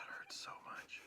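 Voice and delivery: breathless whisper of pain. Real, not acted. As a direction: whisper it through the burn. Mouth burning from hot sauce.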